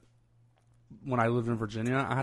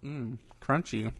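usual speaking voice

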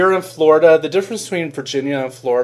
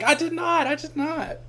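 squeaky high protest, fading softer; high-pitched denial; 8 dB quieter than usual. Retold or imitated. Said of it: "I was like".

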